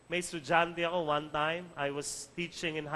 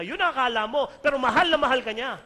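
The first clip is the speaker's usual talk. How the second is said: loud, higher-pitched retort, re-enacted; matching her loud tone; 5 semitones higher, 8 dB louder, faster than usual. Teacher answering a student in her tone, equally loud. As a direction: fire back just as forcefully as the upset student. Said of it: "sinabayan ko yung tono niya ng ganun ding kalakas".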